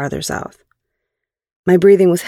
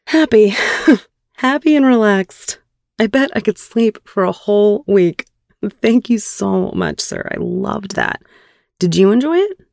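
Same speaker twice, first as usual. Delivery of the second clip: higher, happy and relaxed; beaming happiness; 5 semitones higher than usual. Acted. Woman answering her partner after he checks on her, beaming. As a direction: answer in a delighted, pitched-up voice. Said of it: "I was beaming".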